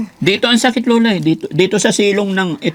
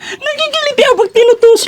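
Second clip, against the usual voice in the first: high squeal, laughing through it; being tickled; 4 dB louder than usual. Real, not acted. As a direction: squeal while being poked. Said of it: "ang ganda mo naman tumawa".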